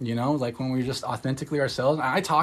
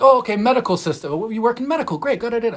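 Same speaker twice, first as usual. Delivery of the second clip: higher, louder, breezy saleslady rattling on; brisk saleslady; 9 semitones higher, 5 dB louder, faster than usual. Retold or imitated. Costco saleslady assuming she works in medicine, chirpy and higher. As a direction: mimic a pushy clerk brushing past your answer. Ladies misreading her job.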